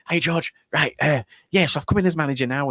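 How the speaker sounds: bouncy new boss, all smiles; energetic and happy. Retold or imitated. New manager greeting the player, cheerful and upbeat. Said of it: "really, really energetic and happy".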